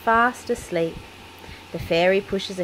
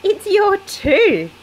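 slow, much higher, louder chuckling delivery; laughing; 13 semitones higher, 8 dB louder, slower than usual. A narrator's reading. Daddy Pig tells Peppa her tooth fell out, laughing. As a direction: laugh the news out slowly. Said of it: "laughs Daddy Pig".

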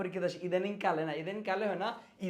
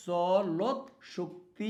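চলৎ Shakti drawn out very slowly; slow dictation; much slower than usual. Shown as a demonstration. Teacher dictating to students, very slow. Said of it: "পরীক্ষার মধ্যে দেওয়া থাকবে".

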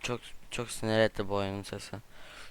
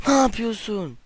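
indignant cry; 10 semitones higher, 5 dB louder, slower than usual. Real, not acted. Gamer scolds game character.

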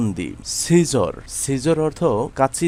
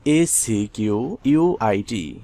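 slow letter-by-letter spelling; 2 semitones lower, slower than usual. Shown as a demonstration. Spelling the word out for learners. (ধীরে অক্ষর ধরে বানান)

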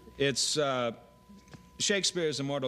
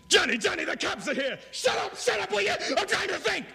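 frantic high shouting, overwrought; intense melodrama; 5 dB louder than usual. Pretend. Mock crime drama, panicked yelling at Johnny. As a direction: scream it like a cornered gangster. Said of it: "Ninety-second dramas that are very intense".

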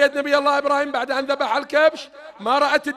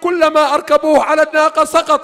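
fervent mourning sermon; 3 semitones higher, 5 dB louder than usual. Real, not acted. Preacher recounting Karbala to mourners, loud. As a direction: hammer the grief home.

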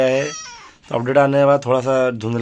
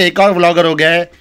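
excited announcement; 5 semitones higher, 7 dB louder than usual. Acted. Announcing new vlogger.

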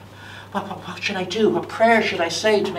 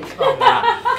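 much higher pleading squeal; whiny child; 5 dB louder, slower than usual. Acted. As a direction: plead like a begging kid.

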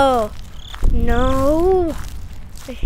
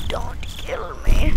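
scared whisper; 4 semitones higher, slower than usual. Real, not acted.

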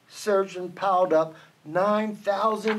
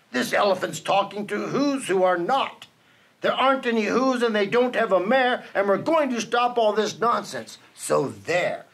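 plain reading voice

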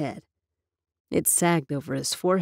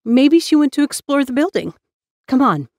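eager; 8 semitones higher, 6 dB louder than usual. A narrator's reading. Eager girl urging cousin.

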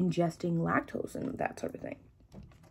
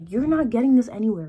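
emphatic; 4 semitones higher, 9 dB louder than usual. Real, not acted. Emphatic promo claim.

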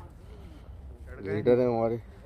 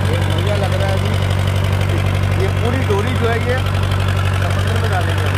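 loud calling out; 8 semitones higher, 7 dB louder than usual. Real, not acted. Calling out on boat deck.

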